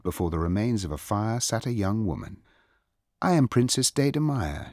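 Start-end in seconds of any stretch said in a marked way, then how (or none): 3.2-4.7 higher-pitched, lightly voiced princess announcement; announcing princess. A narrator's reading. Young princess introduces herself to a visitor, announcing. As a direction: announce yourself as royalty, pitched up. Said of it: "she announced".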